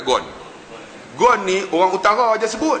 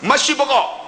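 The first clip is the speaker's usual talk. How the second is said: colloquial Arabic; 6 semitones higher, slower than usual. Retold or imitated.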